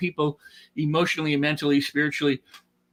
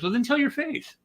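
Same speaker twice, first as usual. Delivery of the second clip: mock teasing; 10 semitones higher than usual. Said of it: "He goes".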